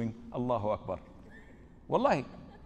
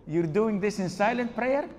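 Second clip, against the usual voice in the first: incredulous question; 6 semitones higher, 6 dB louder than usual. Retold or imitated. Sheikh incredulous at the man.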